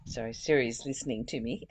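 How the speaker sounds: ordinary delivery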